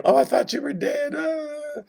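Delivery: high, hammed-up mock sob; mock crying. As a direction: play the weeping brother, half-joking. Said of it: "So he's crying".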